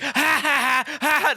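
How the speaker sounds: high, imitated cackle; mocking laughter. Acting out Mandy laughing at the villain, mocking laughter. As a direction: act out her laughing harder in his face. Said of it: "She just laughs harder".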